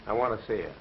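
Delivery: usual speaking voice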